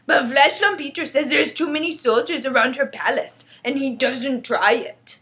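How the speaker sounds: loud, relishing giant voice; emphatic giant passing on gossip; chatty friendly giant. Acted. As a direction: report it as a simple-spoken giant, savouring odd words. BFG explains.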